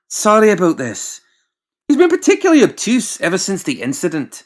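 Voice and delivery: high, loud confiding apology; sheepish aside to the client; apologetic doctor. Acted.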